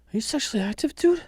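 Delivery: high-pitched doctor impression; Mark Wahlberg. Host repeating the clip's doctor to a patient, high impression. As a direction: imitate the actor's high-voiced question. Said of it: "just the way he says "dude".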